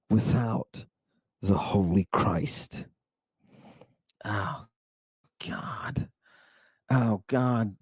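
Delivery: low voice sinking to a whisper; hushed reverence. Real, not acted. Preacher on the Holy Christ, low and hushed. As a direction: let it drop away in awe. Reverent climax, then overcome.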